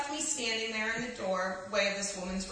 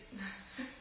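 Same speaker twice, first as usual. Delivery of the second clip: soft laugh; 4 semitones lower, 12 dB quieter than usual. Real, not acted. Reader laughs at own slip.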